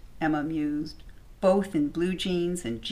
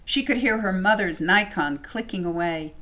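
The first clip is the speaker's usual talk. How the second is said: usual speaking voice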